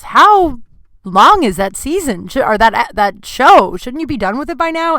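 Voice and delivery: loud, high drawn-out HOW; incredulous mock complaint, swooping up; exasperated. Neighbor complains about the endless show, exasperated.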